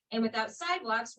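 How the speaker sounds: usual speaking voice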